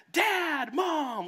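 put-on high, loud child's shout; kids yelling. Kids greeting a parent coming home, high excited yell. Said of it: "your kids yell".

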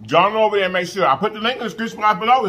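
speaking between bites; talking while chewing. Real, not acted. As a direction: mouth partly full of food. Seen 2: Left speaker takes forkful near 0.7-1.0, then talks with food in mouth.

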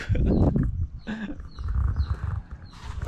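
high, airy giggling while swinging; breathless giggle. Real, not acted. Swinging alone at the beach park, giddy high giggle. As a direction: let out a giddy laugh mid-swing.

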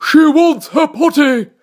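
loud, high, slow announcement; drawn-out military cry; general crying out. A narrator's reading. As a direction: call it out as a general raising the alarm. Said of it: "cried the general".

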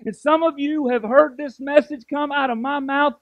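loud, driving preacher voice, pushing each line; a pastor preaching at full force to his church. Acted. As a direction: build it like a revival sermon, loud and fervent.

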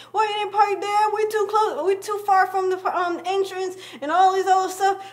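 high, loud imitated nagging; mom yelling. Mom nagging her daughter about the parking spot, high mock yell. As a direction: nag like mom, way up in pitch. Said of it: "she's yelling at me".

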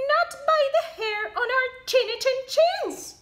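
high, loud, fast squeal. Acted. Two pigs squealing refusal at the wolf. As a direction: squeal it out defiantly. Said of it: "squealed the two little pigs".

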